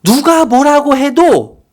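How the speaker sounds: high, loud and slow; impassioned cry. Pretend. Preacher voicing the defiant mother, slow loud cry. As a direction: cry it out with full conviction.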